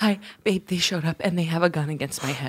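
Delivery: low-volume, furtive phone voice; hushed hostage call. Acted. Hostage calls partner with gun at head, hushed. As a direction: speak softly under threat.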